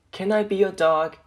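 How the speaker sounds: plain reading voice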